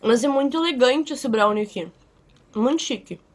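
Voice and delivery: talking between bites of brownie; chewing while talking. Real, not acted. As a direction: mouth partly full of food. Seen 4: Bites brownie at -3s, then talks with pressed, chewing lips.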